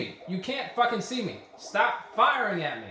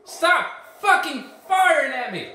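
higher, loud angry yell; furious yell; 6 semitones higher, 7 dB louder than usual. Real, not acted. Gamer yelling at enemies who keep firing, furious. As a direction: scream at them to stop.

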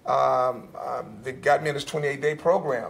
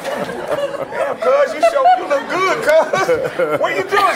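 much higher, louder, laughing through it; a laughing, high-pitched "Damn, cuz" of amazement; amazed laughing greeting; 13 semitones higher, 7 dB louder, slower than usual. Retold or imitated. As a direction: gush at how good she looks. Amazed greeting to glowing cousin.

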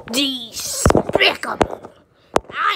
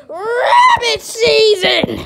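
loud, slow, higher yell breaking into a laugh; mock shout; 8 semitones higher, 6 dB louder, slower than usual. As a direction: belt out the last word, laughing, hammed up. Climax of the season routine.

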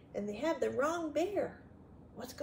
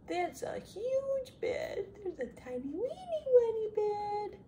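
high, stretched singsong; playful high-pitched marvelling; storytime wonder; 9 semitones higher than usual. Acted. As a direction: coo over the sizes in a high voice. Marvels at bed sizes for children.

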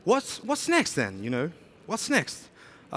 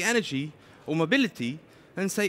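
ordinary delivery

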